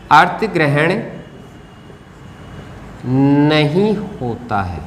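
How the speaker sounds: slow, with a long mid-phrase pause; writing-pace dictation. Real, not acted. Writes the phrase while saying it.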